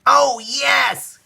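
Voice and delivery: high, loud eager yes; high thrilled child's cry; excited child. Acted. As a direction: burst out with delight as a kid. Child eager to see cockpit.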